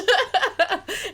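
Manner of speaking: high burst of laughter; real laugh. Real, not acted. Reacting to the murder story, a high laugh. Laughs at own sarcastic thanks.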